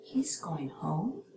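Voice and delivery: slow, HOME lifting into a yes-or-no question; drawn-out rise on HOME; rising question. Shown as a demonstration. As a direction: turn the statement into a question by raising HOME. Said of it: "a rising pitch turns a statement".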